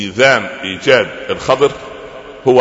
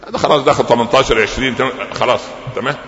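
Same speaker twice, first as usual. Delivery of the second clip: ordinary talk, nothing marked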